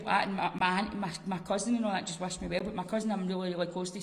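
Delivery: ordinary talk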